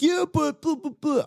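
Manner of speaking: high mocking voice brushing off the point; mocking whiny arguer. People arguing with him about birthdays, mocked in a high whine. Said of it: "they're just like".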